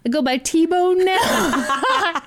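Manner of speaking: high, slow, laughing goofy voice; dopey voice laughing. Retold or imitated. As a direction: say it like a proud dope.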